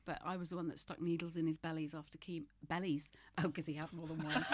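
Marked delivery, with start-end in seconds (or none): none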